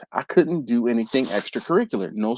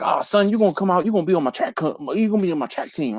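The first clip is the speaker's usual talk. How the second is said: higher-pitched imitation of a Southern track coach; 5 semitones higher, faster than usual. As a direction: put on a Southern coach insisting to a kid. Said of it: "he was real Southern".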